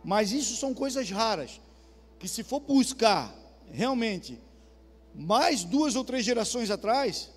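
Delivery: ordinary delivery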